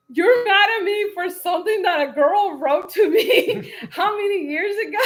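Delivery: high retold disbelief through laughs; laughing incredulity. Husband questions wife's anger over old letter, laughing incredulity. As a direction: imitate his disbelief while cracking up.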